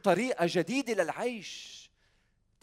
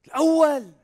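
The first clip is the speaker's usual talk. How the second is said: called-out answer; 10 semitones higher, 11 dB louder than usual. Real, not acted.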